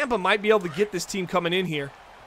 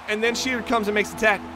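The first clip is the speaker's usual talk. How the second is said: ordinary delivery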